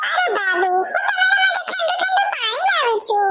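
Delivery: high, sing-song teasing with a laugh; teasing sister-in-law. Acted. Sister-in-law teasing her brother's wife, high and giggly.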